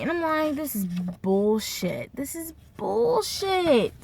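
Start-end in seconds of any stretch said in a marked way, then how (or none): 0.6-2.1 retold disgust. First take of retold outburst. 2.1-3.9 high, drawn-out indignation; retold exasperated outburst. Repeated, escalating retold outburst.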